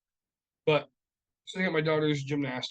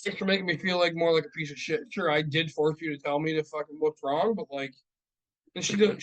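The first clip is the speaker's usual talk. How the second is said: ordinary talk, nothing marked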